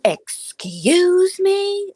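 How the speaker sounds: a high, drawn-out affronted "Excuse me?"; indignant ghost woman. Retold or imitated. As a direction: stretch out the outrage in "Excuse me?". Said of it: "you heard that woman go".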